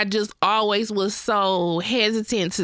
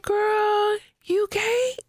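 raised high questioning tone; high surprised quiz; 11 semitones higher, much slower than usual. Retold or imitated. Imitating her sister's reaction, high and amused. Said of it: "And you was like".

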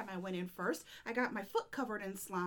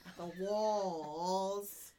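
usual speaking voice

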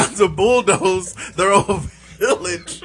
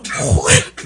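mock Japanese accent; 11 semitones lower than usual. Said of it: "he's got that Japanese accent".

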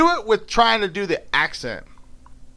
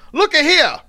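higher, acted scolding in dialect; mad mother; 12 semitones higher, 5 dB louder than usual. Mother answering those who talk back, acted anger. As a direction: call them to attention like a cross mother. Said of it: "when her mom gets mad".

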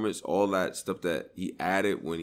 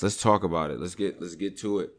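normal talking voice

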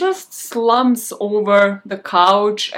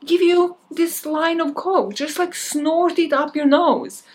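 higher, coaxing party guy voice; pushy party guy; 6 semitones higher than usual. Retold or imitated. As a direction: wheedle like a pushy host.

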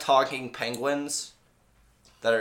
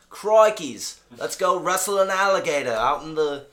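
higher, excited mock Aussie; hammed-up Australian accent; 5 semitones higher, 3 dB louder than usual. Showing friends the Australian mood, higher hammed-up Australian accent. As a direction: exclaim it like a cartoon Australian adventurer. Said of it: "the Australian mood is like".

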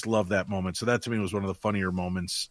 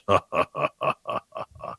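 imitated ghostly sinister chuckle; voiceless, drawn-out string of sinister ha-has; sinister laugh; 3 semitones lower than usual. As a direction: do a recorded ghost's evil laugh. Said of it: "this kinda sinister laugh".

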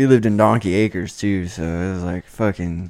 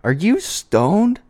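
a hint of a shocked mom, higher and slow; mom's accusation; 11 semitones higher, much slower than usual. Son imitating mom catching him stoned, high and slow. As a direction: mimic mom's high, slow suspicious question. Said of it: "she just fucking".

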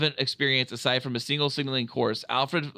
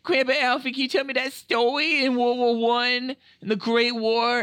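put-on little boy; 9 semitones higher than usual. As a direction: play the small grandson asking sweetly. Little Sam asks grandpa.

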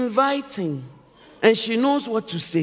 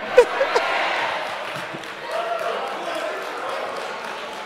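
drawn-out breathy laughter; long airy laugh; 12 semitones higher, 5 dB quieter than usual. Real, not acted.

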